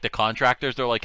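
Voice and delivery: ordinary talk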